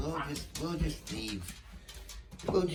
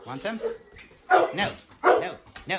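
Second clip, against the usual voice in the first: ordinary delivery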